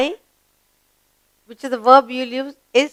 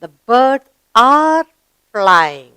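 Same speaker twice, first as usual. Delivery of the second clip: slow, deliberate reading with "are" filled in; slow model reading; 4 dB louder, much slower than usual. Shown as a demonstration. Teacher models the corrected sentence slowly for pupils. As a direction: read it slowly and lean on "are". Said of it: "So we write here".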